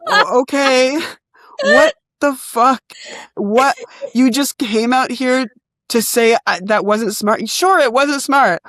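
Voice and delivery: furious retelling, pitch climbing; exasperated rant. Retold or imitated. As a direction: let the frustration spill out. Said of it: "I'm unraveling".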